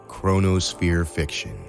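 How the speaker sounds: normal talking voice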